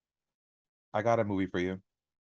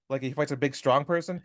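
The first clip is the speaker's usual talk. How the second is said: ordinary talk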